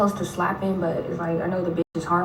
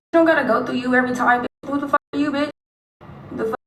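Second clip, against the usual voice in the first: retold angry telling-off; 5 semitones higher, faster than usual. Cussing out the meddling woman, hammed-up angry. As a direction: snap at her, hammed-up anger. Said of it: "yeah, I'm like".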